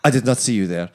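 put-on loud hammy French accent; french chef. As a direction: play a pompous French prince loudly. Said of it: "Begins to talk loudly".

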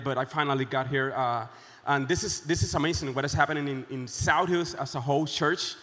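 ordinary delivery

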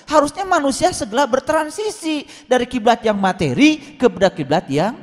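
high-pitched, insistent preaching; impassioned preaching. Real, not acted. Preacher explaining the holy place to congregation, impassioned.

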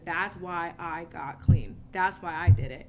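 slow, heavy stress on that's; emphatic. Real, not acted. Telling listeners why she got clean, slow and emphatic. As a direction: lean hard on each word, slowly. Emphatic turning point of recovery.

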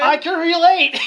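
high, loud squeal of words; real cracked-up holler; laughing shout. Real, not acted. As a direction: yell it through giggles. Shouting through laughter while teased.

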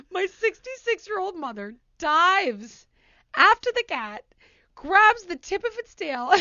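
high, loud telling through laughter; laughing storytelling. Real, not acted. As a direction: barely hold back giggles at the absurd chase. Retells chase, breaking into laughter.

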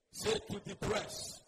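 slow, firm call to repeat. Shown as a demonstration. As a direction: press the congregation to say it up. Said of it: "say it louder".

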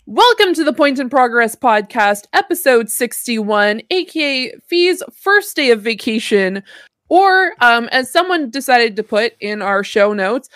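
bright-energy show intro, projected and lifted; podcast opening. Real, not acted. Host welcomes listeners to the podcast, announcer-style opening. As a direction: kick off the episode like a host. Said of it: "I'm gonna do my opening".

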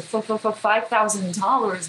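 imitating a stammer on the first word; nervous stutter. Retelling a nervous woman asking a donor for money, stuttering. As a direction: stumble repeatedly before getting the amount out. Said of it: "she started stuttering again".

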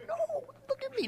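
very high, soft squeaky protest; mock squeak. Pastor joking he begged to be noticed, very high squeak. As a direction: squeak it like a scared kid.

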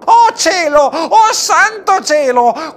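high, loud, drawn-out exclamation of alarm; storybook "oh heavens" wail; hammed-up dismay. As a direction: cry out to heaven in playful panic. Alarmed reaction to freezing wind.

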